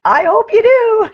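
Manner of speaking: high, loud, slow laughing voice; laughing reply. Retold or imitated. Store lady laughing back at her, high laughing reply. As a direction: chuckle it out kindly. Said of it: "she laughed and she's like".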